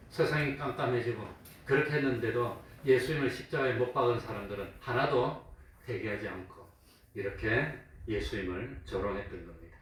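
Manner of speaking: usual speaking voice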